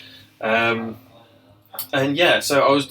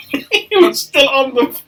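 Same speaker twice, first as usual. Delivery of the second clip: slow, high, half-laughing delivery; giggling through the words; 9 semitones higher, 4 dB louder, slower than usual. Real, not acted. Barber laughing while retelling the crawling customer.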